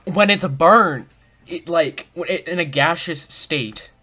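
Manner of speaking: excited. Real, not acted. Excited guess while recalling clue.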